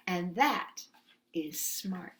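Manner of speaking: ordinary delivery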